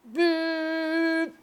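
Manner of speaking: long, high, steady horn note; imitated shofar blast. Shown as a demonstration. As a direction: imitate one simple sustained shofar blow.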